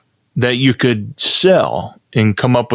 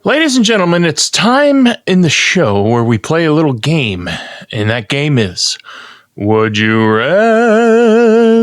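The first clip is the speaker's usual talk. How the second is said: announcer; 4 semitones higher than usual. Acted. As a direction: announce the game grandly. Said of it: "game show announcement".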